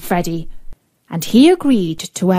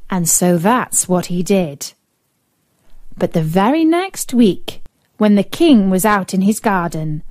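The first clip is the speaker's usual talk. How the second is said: ordinary delivery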